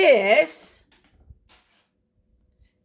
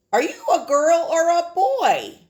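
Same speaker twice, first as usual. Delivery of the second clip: playful; 8 semitones higher, 5 dB louder than usual. Real, not acted. Playful question to class.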